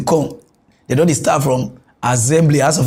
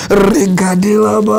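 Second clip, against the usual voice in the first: slow, drawn-out announcing call; catchphrase call-out; 2 semitones higher, 3 dB louder than usual. Acted. Host cueing the senate clip, drawn-out call. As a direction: draw the catchphrase right out.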